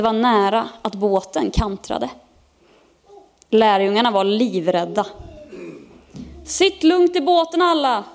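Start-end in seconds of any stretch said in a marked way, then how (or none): 6.4-8.0 high, raised, urgent call to the crew; shouted order. A narrator's reading. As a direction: call out loudly over a storm. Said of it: "skrek Petrus". (ropad uppmaning med hög röst)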